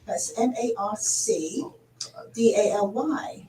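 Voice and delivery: put-on British accent. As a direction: introduce the guest in a posh British accent, unhurried. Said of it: "Should I say in the British accent?".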